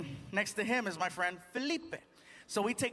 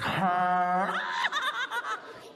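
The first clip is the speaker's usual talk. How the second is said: high, drawn-out cackle; gleeful cackle; 9 semitones higher, 4 dB louder than usual. Acted. Driver having fun cutting cars off, high cackle. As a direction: laugh wildly at the wheel.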